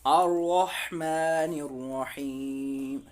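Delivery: slow, chanted "अर्रहमानिर्रहीम"; slow Quranic chant; Quranic recitation. Shown as a demonstration. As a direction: recite it slowly like tilawat. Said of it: "तो क्या पढ़ेंगे?".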